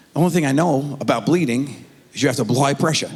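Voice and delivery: usual speaking voice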